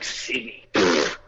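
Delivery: low, quiet scoff; dismissive echo. Retold or imitated. Doubter hears the traveller's home city, quiet dismissive scoff. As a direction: repeat it under your breath, then puff it away.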